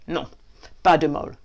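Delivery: lightly put-on Belgian French accent. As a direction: slip back into the Belgian bit. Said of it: "Stop the Belgian thing".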